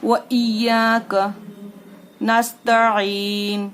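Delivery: slow recited chant, नस्तईन stopped on न; Quranic recitation. Shown as a demonstration. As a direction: recite slowly, clean stop. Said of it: "इस तरीके से".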